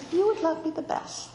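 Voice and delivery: lightly voiced, wide-eyed child; little sister-in-law. Little girl to her new sister-in-law, lightly childlike. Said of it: "opened her big brown eyes".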